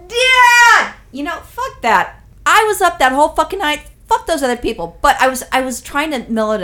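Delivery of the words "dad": high, loud drawn-out holler; mock scream across a plane cabin; imitated yelling. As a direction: yell it out like an annoying passenger. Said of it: "some motherfucker's yelling".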